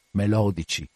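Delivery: ordinary delivery, nothing marked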